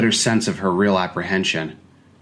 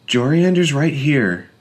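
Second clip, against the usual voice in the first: soothing mother; 7 semitones higher than usual. A narrator's reading. Madeline soothing child.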